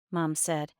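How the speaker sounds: plain reading voice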